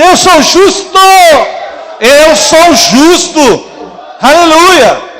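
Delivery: high, loud, triumphant shout. Shown as a demonstration. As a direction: shout it out in victory. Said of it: "Fala assim".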